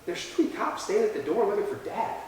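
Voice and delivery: soft, hushed report; hesitant news-breaking. Retold or imitated. Teenager telling parents about cops, quietly uneasy. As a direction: act out a timid, uneasy announcement.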